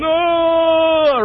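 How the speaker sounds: high mimicked shout; imitating her no. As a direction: echo the girl's cry back. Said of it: "she's looking at me going".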